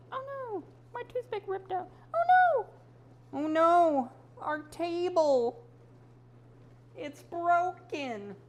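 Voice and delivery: high, loud cartoon panic; panicked high voice. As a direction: squeal it like a tiny toy in distress. Toothpick toy breaks, mock panic.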